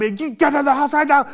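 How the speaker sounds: dad ordering his daughter inside, angry and loud; angry dad command. Retold or imitated. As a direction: snap it like a furious dad.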